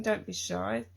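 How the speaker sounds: ordinary talk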